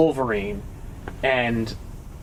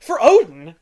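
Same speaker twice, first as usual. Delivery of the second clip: raised, louder questioning of the catchphrase; incredulous echo; 4 semitones higher, 6 dB louder than usual. Retold or imitated. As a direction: throw the battle cry back as a question. Challenging the catchphrase with mock scorn.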